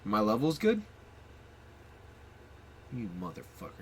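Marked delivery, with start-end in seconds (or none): 2.9-3.7 muttered insult. Acted.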